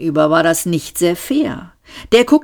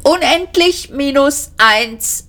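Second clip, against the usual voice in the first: high, slow computer announcement voice; chattering computer; 9 semitones higher, slower than usual. A narrator's reading. The computer reports improbability status, high-pitched machine voice. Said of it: "schnatterte der Computer".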